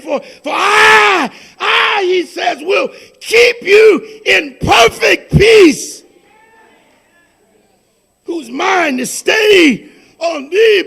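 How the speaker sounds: loud, fervent pulpit proclamation; preaching at full force. Acted. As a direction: thunder the scripture over the congregation. Preaching, leading a declaration.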